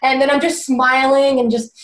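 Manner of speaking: ordinary talk, nothing marked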